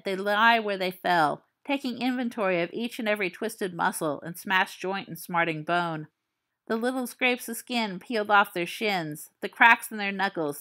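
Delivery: plain reading voice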